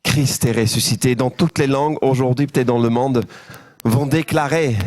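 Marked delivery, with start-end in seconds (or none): none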